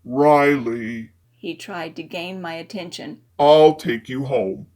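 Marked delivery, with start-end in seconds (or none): 0.0-1.1 low male character voice; man's voice. A narrator's reading. Fitz speaks. 3.4-4.7 low male character voice; man's voice. A narrator's reading. Fitz offers.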